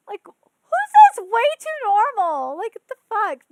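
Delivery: who says that, stretched out loud; outraged at the dub wording; incredulous outburst. Real, not acted. Mocking dub line, disbelief.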